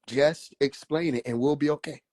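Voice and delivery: ordinary delivery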